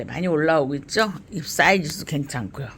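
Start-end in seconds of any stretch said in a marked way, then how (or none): none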